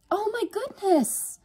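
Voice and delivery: very high, drawn-out delight; overjoyed. A narrator's reading. Yvonne spotting her sister's friend, overjoyed. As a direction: squeal it with joy, slowly. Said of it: "her face ablaze with joy".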